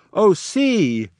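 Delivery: a sudden startled outcry; sudden cry. Acted. Albany suddenly crying out, startled. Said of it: "suddenly he cries out".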